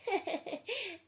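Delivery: a short high teasing laugh; mocking laugh. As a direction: laugh off the brother's fear. Brother mocks ghost fear.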